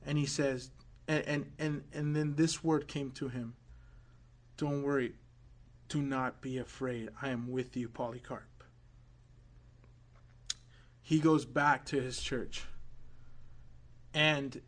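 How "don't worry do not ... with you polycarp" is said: soft and low, the Lord comforting; quiet, reassuring voice of the Lord; hushed voice of God. Retold or imitated. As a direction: murmur it gently as a divine reassurance. Voice of the Lord speaking.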